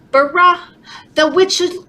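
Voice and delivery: ordinary delivery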